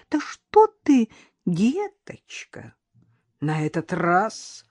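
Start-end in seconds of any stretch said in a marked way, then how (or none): 0.1-2.7 slow, drawn-out words dropping to a hush; icy menace. A narrator's reading. Mother-in-law warning Karina off her son, icy. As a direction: stretch it coldly, let the endearment fade. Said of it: "голос свекрови прозвучал, как скрип железа".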